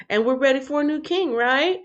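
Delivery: quoting a viewer. Retold or imitated. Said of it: "Mermaid Star says".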